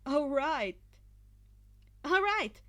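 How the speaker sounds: psyched up. A narrator's reading. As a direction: pump yourself up.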